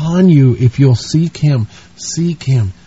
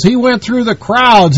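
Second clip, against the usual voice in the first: ordinary talk, nothing marked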